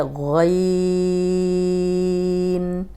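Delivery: غین drawn out thick; slow heavy غین demo; heavy ghain. Shown as a demonstration. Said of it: "یہ موٹا حرف بھی ہے".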